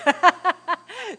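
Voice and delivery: loud embarrassed laugh bursting out; burst of laughter. Real, not acted. Forgets to show the photos.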